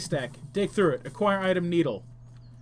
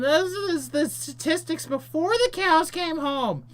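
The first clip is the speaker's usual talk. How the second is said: high, slow comic voice; 10 semitones higher, slower than usual. Acted. Friend's punchline, high and slow.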